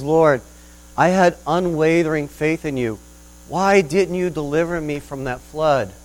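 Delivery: indignant plea. Acted. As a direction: demand an answer from God. Preacher complains to God.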